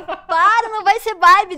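discussão encenada, aguda e alta; protesto encenado. Retold or imitated. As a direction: corta a ideia com voz mais aguda e forte. Encena discussão sobre o nome. (protesto alto e agudo, imitando briga)

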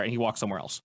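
normal talking voice

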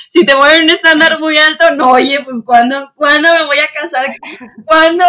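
a playful half-wail; laughing mock complaint. Real, not acted. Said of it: "queja exagerada entre risas". (queja en broma, riendo y más fuerte)

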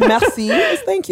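bright mock-teacher voice, high; teasing high correction. Teasing French lesson.

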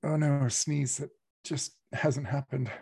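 usual speaking voice

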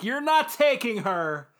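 put-on outburst of defiance, pitched well up; acted defiant protest. Giggles shows up to stop them, mock defiant protest. Giggles confronts rivals in retold fight.

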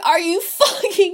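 high, laughing incredulity; scandalised half-laughing question; appalled disbelief. Retold or imitated. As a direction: react aghast with a laugh in it. Reacting to sitcom joke.

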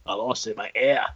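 Nick Fury in a light mock Australian accent. Podcaster teasing a cohost, lightly mock Australian. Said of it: "you made him sound Australian".

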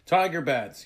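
high, loud alarmed yell; sudden loud cry of alarm. A narrator's reading. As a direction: yell out a warning. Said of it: "yelled Tag".

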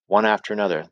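plain reading voice

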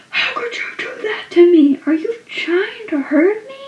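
high, hurt mock outburst; angry hurt Dawson. Dawson confronting Joey, high and hurt. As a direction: plead it, high and wounded. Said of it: "so angry".

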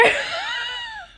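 can't hold it in and crack up high; a sudden shrieking giggle fit; burst of high laughter. Real, not acted.

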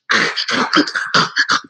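breathy, self-conscious laugh; embarrassed laugh. Real, not acted. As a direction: laugh at yourself through tears. Laughing at her own crying.

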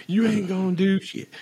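high mocking taunt; drunk girl taunt. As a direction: sneer it drunkenly. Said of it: "the girl's like".

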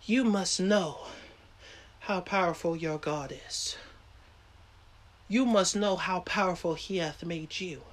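hushed, low prayerful preaching; soft worship call. Acted. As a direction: bring it down soft and reverent. Quiet worship call after climax.

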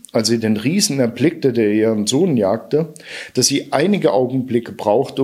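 plain reading voice

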